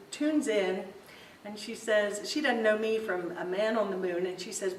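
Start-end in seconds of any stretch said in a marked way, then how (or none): none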